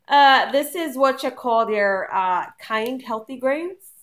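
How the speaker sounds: drawn-out, put-on French Canadian accent; slow, playfully fake French Canadian lilt. Vlogger showing a snack to viewers, fake French Canadian accent. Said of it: "that's my fake Cana-, French Canadian accent".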